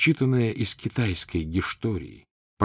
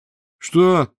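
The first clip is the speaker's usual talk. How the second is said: sharp, much higher, louder "what?"; surprised; 10 semitones higher, 8 dB louder than usual. A narrator's reading. Zurov hearing the father plans to move in, startled. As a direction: blurt it out in astonishment. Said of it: "удивился Зуров".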